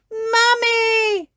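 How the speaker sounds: high, loud call for Mummy; crying out. A narrator's reading. Peppa calls her mother for help, high cry. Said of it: "cried Peppa".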